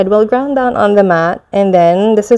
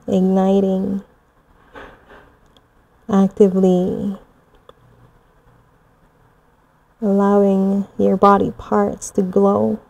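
guided meditation; 4 dB louder than usual. Acted. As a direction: guide them gently through the visualization. Guided moon visualization.

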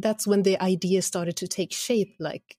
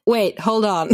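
mock louder wait; 3 semitones higher, 6 dB louder than usual.

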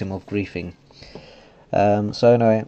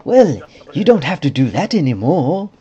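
higher, louder goofy put-on voice; silly voice; 8 semitones higher, 8 dB louder than usual. Shown as a demonstration. Vlogger trying out the owner's voice, silly voice. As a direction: put on a loud silly swooping voice. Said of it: "silly voice on".

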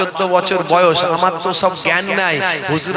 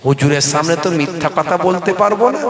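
normal talking voice

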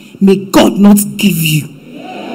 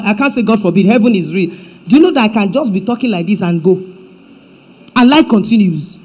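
ordinary delivery

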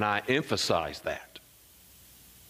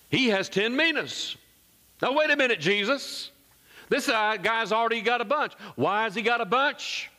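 high-pitched, hammed-up objection; incredulous protest; 10 semitones higher than usual. Bystanders protesting to Jesus that he has plenty, incredulous. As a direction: object in mock disbelief, pitch way up.

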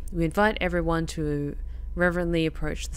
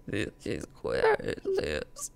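spooky low voice; 9 semitones lower, 6 dB quieter, slower than usual. Pretend. Spooky low voice for a monster's lair.

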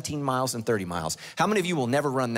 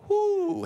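preacher whooping at the miracle; excited whoo. Real, not acted. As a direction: whoop like the crowd at a win. Excited cry after fire falls.

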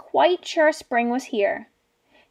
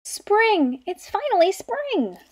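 much higher, bursting with joy; excited cry; 8 semitones higher, slower than usual. Acted. Alfie discovering spring outside the cave, joyful high cry. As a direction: shout it like a cub who finally sees spring.

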